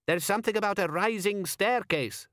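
high-pitched bystander offering a theory; donkey-theory bystander. A narrator's reading. Bystander explaining to the crowd why donkeys climb, high-pitched.

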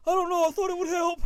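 high, loud pained pleading; hammed-up panic in agony; frantic wail. Wife explaining herself mid-cramp, acted frantic wail. Acting wife's frantic reply.

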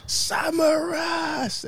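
high, slow stretched-out yell; shouting a player's name for the group, drawn out; excited shout. Real, not acted. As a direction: roar the name like a stadium chant. Hyping a Greek player's name.

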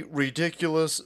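usual speaking voice

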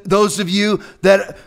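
ordinary talk, nothing marked

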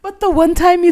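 ordinary delivery

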